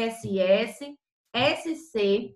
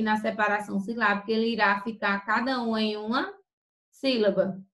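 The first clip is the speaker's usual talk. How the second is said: ordinary delivery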